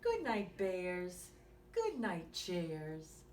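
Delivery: put-on lively bedtime story read to a small child; reading to children. Reading a bedtime story to young listeners, lively and gentle. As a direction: read it like a bedtime picture book. Reads picture book aloud.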